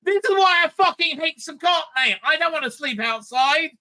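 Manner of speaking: high, heated mocking rant; exasperated rant. Real, not acted. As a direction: let the disgust boil over. Ranting at crying contestants.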